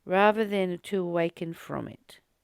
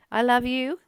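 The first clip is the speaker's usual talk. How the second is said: loving sign-off; 5 semitones higher, 7 dB louder than usual. Real, not acted.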